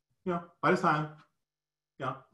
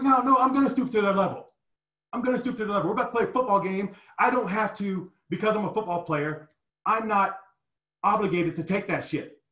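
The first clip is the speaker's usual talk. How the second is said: high, heated defiant answer; defiant retort; 7 semitones higher than usual. Retold or imitated. Player refusing the coach's advice, high heated defiance. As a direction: throw it back at the coach in defiance. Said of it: "I was like".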